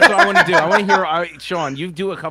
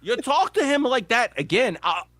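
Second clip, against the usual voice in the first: Walken quote; 7 semitones higher than usual. Retold or imitated. Continuing Walken movie quote.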